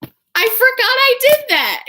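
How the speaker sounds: very high-pitched panicked admission; mock shocked realisation. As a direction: squeal it like a sudden realisation. Victor's acted sudden realisation.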